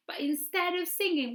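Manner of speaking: normal talking voice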